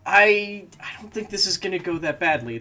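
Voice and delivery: ordinary delivery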